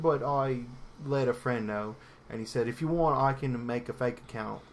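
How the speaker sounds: put-on halting British accent drifting towards Australian; dodgy British accent. Podcaster telling an embarrassing crush story, Australian-sounding British accent. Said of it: "this is my British accent".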